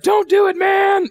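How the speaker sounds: high, loud cry of alarm; panicked mom shout. Retold or imitated. Mom yelling at a kid with Kool-Aid by the carpet, high panicked shout. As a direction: shriek it like a mom seeing spilled Kool-Aid. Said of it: "Moms everywhere like".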